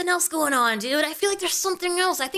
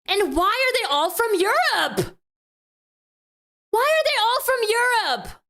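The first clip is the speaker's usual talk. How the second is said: exasperated, higher-pitched disbelief; baffled outburst; 4 semitones higher than usual. Real, not acted. Streamer reacting to all the girls being European, baffled. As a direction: demand to know, incredulous.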